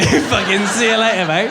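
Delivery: normal talking voice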